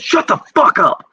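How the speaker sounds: a high, barking drill-sergeant yell; retold bay-door outburst, pitched very high. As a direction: bark it like an angry drill sergeant. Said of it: "And he goes".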